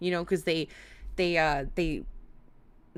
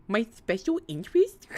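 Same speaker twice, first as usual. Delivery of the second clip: breaks into high laughter; laughing through the words; 10 semitones higher, 5 dB louder than usual. Real, not acted. Laughs at own slip.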